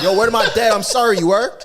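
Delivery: put-on loud, high New York accent; brash New York accent apology. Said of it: "Did he apologize in a New York accent?".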